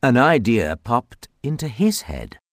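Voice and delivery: usual speaking voice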